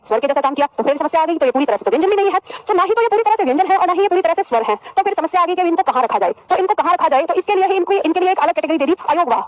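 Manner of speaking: ordinary talk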